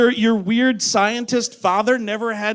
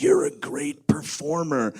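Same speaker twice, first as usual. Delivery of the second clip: lightly voiced low, quiet movie star; a hint of a slow, soft-spoken director; Clint Eastwood; 6 semitones lower, 7 dB quieter, slower than usual. Director praising the singer on set, low, quiet and slow. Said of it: "went up to Arnold and was like".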